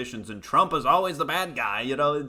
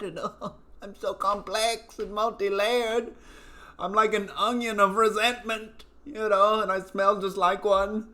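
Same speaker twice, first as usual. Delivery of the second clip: high, mocking valley-girl voice; mock woke student; 8 semitones higher than usual. Mocking indoctrinated college kids, high smug voice.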